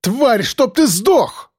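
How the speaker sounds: high, slow, drawn-out curse; медленное яростное проклятие; slow furious curse. A narrator's reading. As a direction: spit out the curse slowly and hatefully. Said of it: "выкрикнула Кобра".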